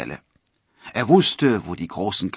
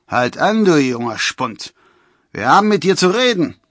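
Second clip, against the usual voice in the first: high, loud forester's challenge; raised voice, bossy hail; calling out; 7 semitones higher, 8 dB louder than usual. A narrator's reading. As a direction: shout to stop someone. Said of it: "rief ein anderer Förster".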